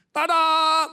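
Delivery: high, loud "ta-da!" flourish; seruan kejutan. Retold or imitated. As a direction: belt out a showy reveal. Reveals surprise balance in story. (seruan "tada" lantang)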